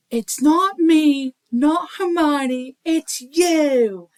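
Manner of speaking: loud, high, drawn-out movie cry; slow, high dramatic yell, stretching each phrase; Ron. Acted. Librarian quoting Ron at the chess game, dramatic high cry. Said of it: "Ron's all of a sudden like".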